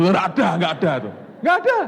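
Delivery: loud, high insistence; emphatic shout. Acted. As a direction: hammer home there is none. (seruan tegas dan keras)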